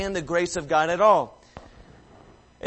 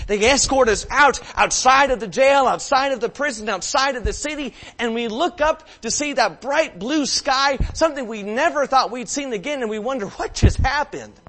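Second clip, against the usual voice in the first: louder, higher, full preaching force; fervent preaching; 6 semitones higher, 5 dB louder than usual. Real, not acted. Preacher telling the congregation of leaving the prison, fervent. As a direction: proclaim the freedom with fervor.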